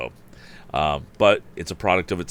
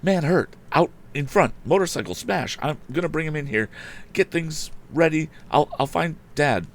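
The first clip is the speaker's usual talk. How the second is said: high, loud, rushed; urgent excitement; 11 semitones higher, 4 dB louder than usual. Acted. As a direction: blurt it out in a hurry. Tom breathless, excited.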